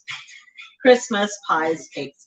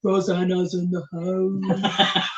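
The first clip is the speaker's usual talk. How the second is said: forcing a lower voice; 4 semitones lower, 4 dB quieter than usual. Shown as a demonstration. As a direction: push the voice down on purpose. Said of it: "I need to talk".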